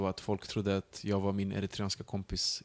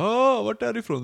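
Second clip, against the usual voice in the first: much higher, louder eager question; eager stranger; 13 semitones higher, 7 dB louder than usual. Retold or imitated. The stranger on the train, loud and high. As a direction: pounce with a friendly question. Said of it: "Så bara".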